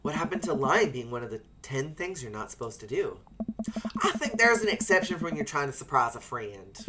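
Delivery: ordinary talk, nothing marked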